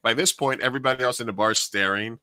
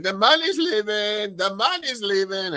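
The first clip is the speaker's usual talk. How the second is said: mock drunk wail; 10 semitones higher than usual. Bartender mimicking the drunk customer being walked out, higher and whiny. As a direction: lightly mimic a drunk woman whining as she's led out. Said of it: "Literally, she was like".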